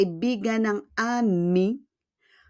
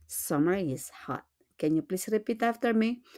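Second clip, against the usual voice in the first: ordinary talk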